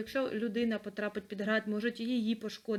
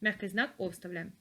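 ordinary talk, nothing marked

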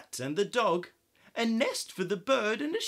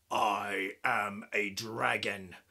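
low, slow, whispered dragon threat; menacing dragon; 9 semitones lower than usual. Acted. Dragon threatens to eat the witch, low slow whisper. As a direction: hiss it low like a hungry dragon. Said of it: "heard a roar that was scary".

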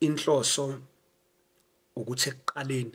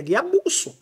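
excited; 13 semitones higher, 5 dB louder, slower than usual. Retold or imitated. Celebration at home.

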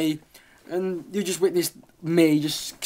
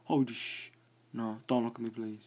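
much softer, lower hushed plea; scared pleading; 4 semitones lower, 11 dB quieter than usual. Real, not acted. Gamer pleads with a nearby Enderman, softly frightened. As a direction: beg the monster quietly.

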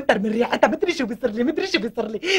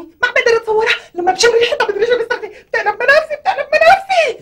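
panicked rising wail; 9 semitones higher, 6 dB louder than usual. Acted. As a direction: pitch climbing into a shrill distressed cry, louder. Pregnant wife panics, nausea.

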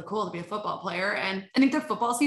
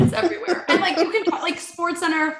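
laughing; 7 semitones higher, 4 dB louder than usual. Real, not acted.

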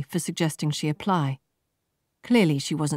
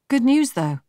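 bright chirp, higher and louder; chirruping; 9 semitones higher, 6 dB louder than usual. A narrator's reading. Laura announcing good news to Marta, chirping high and loud. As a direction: chirp it like a cheerful bird. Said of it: "chirruped Laura".

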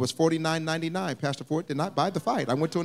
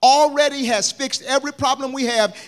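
full-force preaching; 4 semitones higher than usual. Acted. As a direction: roll the list to a climax.

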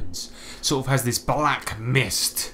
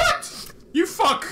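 high, outraged squeak; squeaky and furious; outraged creature voice; slower than usual. Acted. [name] outraged in voice.

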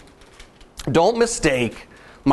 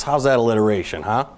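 ordinary talk

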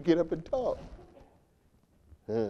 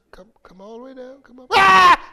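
sudden shout; 12 dB louder than usual. Retold or imitated. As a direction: startle them with a shout. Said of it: "heat of the argument".

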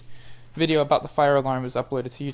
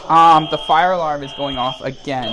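shouting over fire alarm; 10 dB louder than usual. Real, not acted. Speaking over blaring fire alarm.

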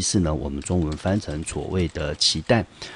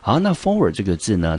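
ordinary delivery

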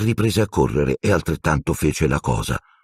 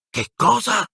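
alarmed question. Acted. Boys woken in alarm.

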